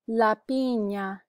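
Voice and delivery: "La piña" said slowly for repeating; slow "La piña", clear ny sound; careful pronunciation model. Shown as a demonstration. Teacher gives the word for pineapple, slow careful model. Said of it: "You can repeat for pronunciation".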